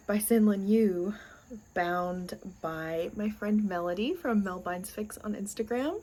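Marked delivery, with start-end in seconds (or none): none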